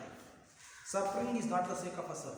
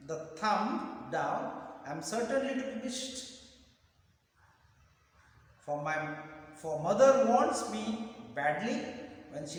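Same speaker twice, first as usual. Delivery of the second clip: raised, deliberate reciting voice; slow poem reading; 4 semitones higher, 5 dB louder than usual. Shown as a demonstration. Teacher reads the pencil poem to students, slow raised recitation. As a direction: declaim each phrase, pausing between them. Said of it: "I'll read the poem slowly".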